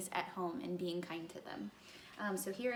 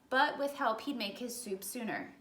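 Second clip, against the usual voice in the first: ordinary delivery, nothing marked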